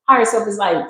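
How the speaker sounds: ordinary talk, nothing marked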